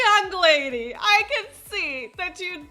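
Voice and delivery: put-on amused condescending old man. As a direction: talk down to her through laughter. Old man scolds the realtor.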